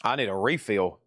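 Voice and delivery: normal talking voice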